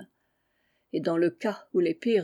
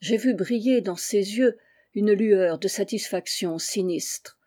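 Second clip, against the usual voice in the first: ordinary delivery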